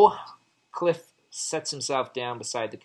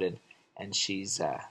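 normal talking voice

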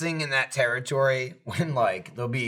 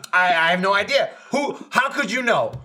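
mock Jeremy; 6 semitones higher, 3 dB louder than usual. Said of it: "or he goes".

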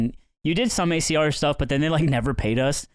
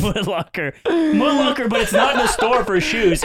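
laughing; 6 semitones higher than usual. Real, not acted. Cracking up at own joke.

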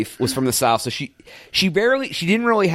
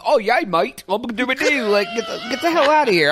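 higher, hammed-up English "mate" voice; mock English accent; 8 semitones higher than usual. Mocking people who come back from England, fake English accent. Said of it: "like, England and".

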